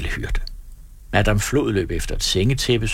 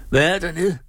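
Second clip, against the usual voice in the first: calling out; 9 semitones higher than usual. A narrator's reading. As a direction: hail someone from a distance. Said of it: "råbte karlene".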